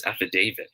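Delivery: "affidavit" with DA said as "day"; correct long A. Shown as a demonstration. Teacher says how it should be pronounced, long-A affidavit. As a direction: quickly say "affidavit" as af-fi-DAY-vit. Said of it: "it should be pronounced as".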